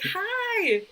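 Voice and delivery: bright high greeting voice; chirpy high hello, overly friendly. Retold or imitated. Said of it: "I'm like".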